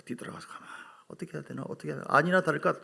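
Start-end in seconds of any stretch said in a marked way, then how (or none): 0.0-0.7 low whispered storytelling; hushed whisper. Retold or imitated. Sneaking after his daughter. 1.1-1.6 "어떻게 해야 되나?" whispered to himself; whispered worry. Retold or imitated.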